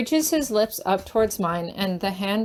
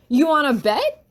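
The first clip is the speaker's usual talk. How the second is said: higher and louder, daring him; defiant yell; 5 semitones higher, 5 dB louder than usual. Acted. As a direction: yell it back as a challenge. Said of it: "I yell".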